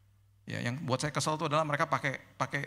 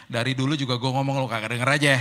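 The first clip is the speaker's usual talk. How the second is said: louder, cheeky retort; teasing God voice; 7 dB louder than usual. Retold or imitated. God answering the preacher's complaint, cheeky and louder. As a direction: tease him like an old friend, louder.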